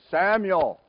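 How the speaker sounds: loud, high call of a name. Retold or imitated. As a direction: call to someone asleep in another room. God's voice calls the sleeping boy.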